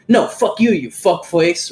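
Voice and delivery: sudden high burst, then dragged slowly; hammed-up hostile brush-off; mock aggressive retort. Telling off a gym guy who wants the equipment, mock aggressive. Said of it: "threaten him and get all aggressive".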